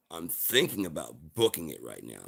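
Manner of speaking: normal talking voice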